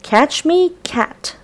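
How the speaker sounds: squeaky little mouse, taunting. Acted. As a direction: tease the cat in a small high voice. Mouse character voiced in story.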